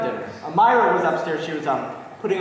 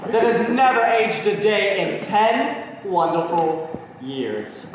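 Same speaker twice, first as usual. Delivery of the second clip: lingering toast. Acted. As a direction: savour each word of the toast. Husband's lingering, ardent toast.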